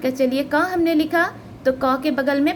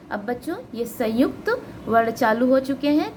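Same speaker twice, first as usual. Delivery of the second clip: ordinary delivery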